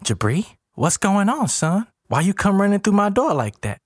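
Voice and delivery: high, startled questioning; high-pitched concern; shocked, worried grandma. A narrator's reading. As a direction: question your grandson in alarm. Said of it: "jumps up shocked and worried".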